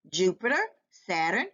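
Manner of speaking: plain reading voice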